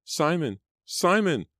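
high, rising piercing shriek. Acted. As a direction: shriek his name in terror. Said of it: "Angelica shrieked behind him".

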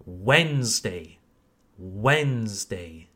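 slow, low model of Wednesday as "WENZ-day"; Wednesday with silent d, drawn out and falling; correct pronunciation. Shown as a demonstration. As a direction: carefully say Wednesday in two syllables. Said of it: "Mercredi en anglais se prononce".

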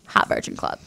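breathy whispered title. Acted. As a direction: whisper it like a secret. Whispered after intro.

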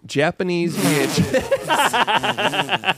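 slow, high, drawn-out reveal. Real, not acted. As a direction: present it slowly with awe. Presenting a prized item.

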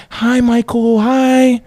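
way up high, airy and eerie; sing-song greeting from a dazed girl; high spacey girl voice. Retold or imitated. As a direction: visitor in a trance greets her old co-star, high sing-song. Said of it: "She's like".

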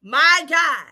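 insistent preaching built on repeated phrases. Real, not acted. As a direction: preach it urgently, rising to emphatic peaks. Preaches on the verse just read.

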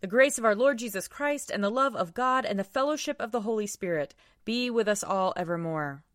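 ordinary talk

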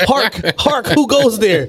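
put-on playful old herald call; mock sentry. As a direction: call out like a castle guard.

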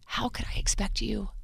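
breathy whisper, as if confiding to mom; whispered quote. Retold or imitated. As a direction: hush the line right down to a whisper. Quoting her own letter to mom.

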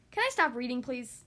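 ordinary delivery

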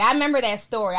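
ordinary talk, nothing marked

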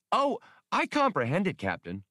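high, chirpy proud droid; droid with pride. Acted. Max answering Han's scoff, with a note of pride. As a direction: let a little pride slip in. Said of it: "a note of pride".

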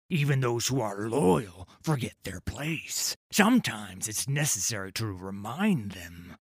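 a lightly voiced cold, patient pirate captain. Pirate captain lecturing the boy, lightly voiced cold menace. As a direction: savour each word like a calm butcher.

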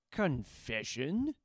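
slow, puzzled question; drawn out in confusion; bemused. Acted. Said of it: "asked the bemused warlord".

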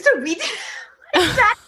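giggling through a funny memory; can't finish for laughing. Real, not acted. Laughing while retelling a disaster.

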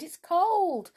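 high, alarmed exclamation; distressed high cry; cried out. A narrator's reading. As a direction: wail it in mounting panic, loud and drawn out. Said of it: "he cried".

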